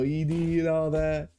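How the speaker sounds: ordinary talk, nothing marked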